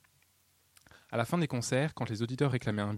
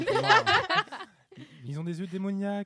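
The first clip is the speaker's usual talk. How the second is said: laughing; 8 semitones higher, 3 dB louder than usual. Real, not acted. Laughing about demonic pigeons.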